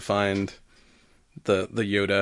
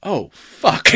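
stunned surprise; 5 semitones higher, 4 dB louder than usual. Retold or imitated. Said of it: "we're like".

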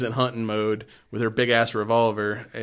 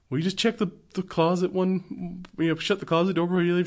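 put-on high timid kid; 6 semitones higher, 3 dB quieter than usual. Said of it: "and he's like".

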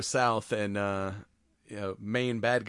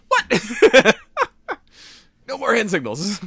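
much higher and louder, laughing fed-up partner; mock exasperation; 13 semitones higher, 11 dB louder than usual. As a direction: throw up your hands, laughing in disbelief. Hand-signal confusion in firefight.

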